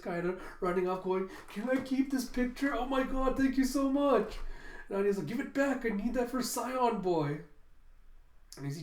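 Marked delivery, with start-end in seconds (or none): 1.5-4.4 high-pitched excited gushing; fanatical fangirl. Acted. Becky running off with the picture, fanatical fangirl gushing. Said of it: "how fanatical Becky's going". 5.3-7.4 higher-pitched urgent demand; indignant child. Acted. Anya chasing her friend for the photo, indignant demand.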